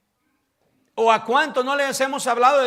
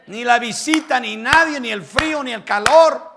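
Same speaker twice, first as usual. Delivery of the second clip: louder, building to a shouted declaration; full-force preaching; 5 semitones higher, 13 dB louder than usual. Acted. Pastor urging the church to love God, shouted preaching. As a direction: proclaim it with rising fire.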